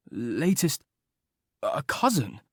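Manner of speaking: faltering, halting surprise; stammered disbelief; stammering. A narrator's reading. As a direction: trip over the words in confusion. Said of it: "stammered Charles".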